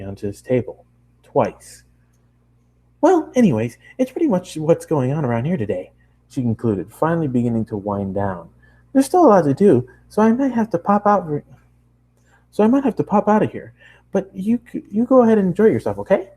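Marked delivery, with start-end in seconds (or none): 3.0-5.9 high, excited nonstop chatter; bubbly pony girl. Acted. Bubbly girl chatters at the new traveler, high and excited. Pinkie winds down. 8.9-16.3 high, excited nonstop chatter; bubbly pony girl. Acted. Bubbly girl chatters at the new traveler, high and excited.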